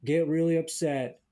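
a hint of an earnest old-timer; failed impression. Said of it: "Let me try that impression again".

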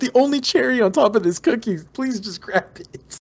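desperate plea. Retold or imitated. Panicking over collapsing cookie.

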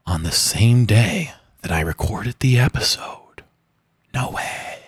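whispered mock secret; hushed, breathy aside; whispering.

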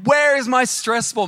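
high-pitched mock yell, not full volume; retold scream. Child searching the beach for a stress ball, mock scream. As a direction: cry it out like an upset kid, held back. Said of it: "like, I'm screaming".

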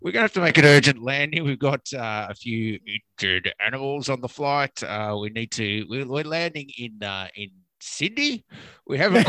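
low captain PA announcement, laughing partway; captain announcing an urgent landing; over the intercom. Pretend. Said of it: "is your captain speaking".

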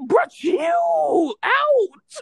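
high, slow, shrieking through laughter; real laughing shout at a friend. Real, not acted. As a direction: yell it while cracking up. Friend cracking up, protesting.

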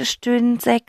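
usual speaking voice

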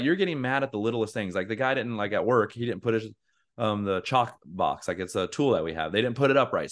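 ordinary talk

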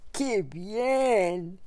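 big bad wolf, gruff and gleeful. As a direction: relish it like a hungry wolf. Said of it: "gritó el lobo".

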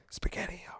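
breathy whispered taunt; a sly whispered tease. Retold or imitated. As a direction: whisper it right in their ear. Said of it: "I whisper that to them".

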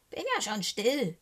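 mildly croaky raven; lightly voiced, sulky raven croak. A narrator's reading. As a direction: croak back grudgingly. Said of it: "krächzt Socke".

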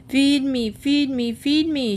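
high shrieked demand; shrieking. Acted. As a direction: shriek it repeatedly. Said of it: "shrieked Penelope".

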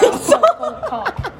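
high, slow, laughing voice; high mock voice. Shown as a demonstration. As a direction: stretch it out high and silly.

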